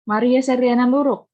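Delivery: loud roll call of a name. Real, not acted. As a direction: call the student's name out loudly. Calling on a student by name.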